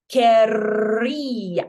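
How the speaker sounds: "querrías" very slow, rolled RR and stressed í; double R, accented. Shown as a demonstration. Teacher models a busy word, rolled double R in "querrías". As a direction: roll the R long in "querrías" and lean on the í. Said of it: "double R".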